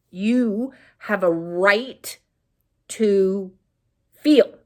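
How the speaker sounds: slow, tender self-affirmation. Shown as a demonstration. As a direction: give yourself permission, word by word. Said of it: "affirming permission slowly".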